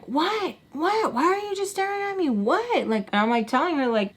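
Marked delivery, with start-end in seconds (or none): 0.0-2.9 high, fast, indignant demand; mock mad. Sam getting mad at being stared at, high mock outrage. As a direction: imitate her snapping, pitched way up. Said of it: "She gets mad".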